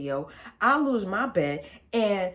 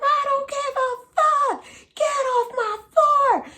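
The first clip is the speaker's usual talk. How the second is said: much higher, louder and slower furious scream; imitated screaming; 8 dB louder, slower than usual. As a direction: scream her off the floor like a madwoman. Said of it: "she's screaming off the top of her lungs".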